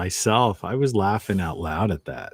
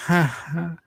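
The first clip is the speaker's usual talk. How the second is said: short, hollow fake laugh, pitched up; feigned laughter; 8 semitones higher than usual. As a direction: imitate someone laughing only because they're supposed to. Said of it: "laughing like they're supposed to laugh".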